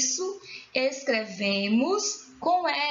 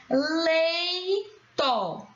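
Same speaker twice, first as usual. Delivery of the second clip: "Leito" in two long, separated syllables, pitched up; split syllables; 4 semitones higher than usual. Shown as a demonstration. Teacher showing the stressed syllable, "Leito" split up. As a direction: stretch "Lei", then place "to". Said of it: "Qual é a sílaba tônica".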